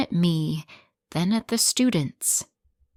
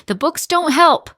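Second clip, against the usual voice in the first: loud, high outburst; loud cry of frustration; inner shout; 9 semitones higher, 7 dB louder than usual. Acted. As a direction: burst out loud and high in protest. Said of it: "inside I want to shout".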